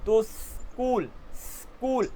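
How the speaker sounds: स्कूल with a long hissed s, then कूल; snake-like hiss. Shown as a demonstration. Teacher shows students the snake hiss in स्कूल. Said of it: "just like a snake".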